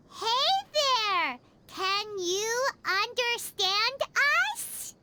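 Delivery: slow, spelled-out question to a robot; talking slowly. Acted. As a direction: drag each word out slowly. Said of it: "talking slowly isn't gonna, like, help".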